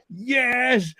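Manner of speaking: a high, gleeful cheer; gleeful yes. Retold or imitated. Speaker reliving the car crash that got them out of cross-country, high gleeful cheer. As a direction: cheer it like a kid let off the hook. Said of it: "I literally went".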